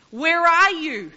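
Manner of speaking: a loud, slow call of "Where are you?". Acted. God calling to Adam and Eve hiding in garden, loud slow call. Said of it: "he calls out".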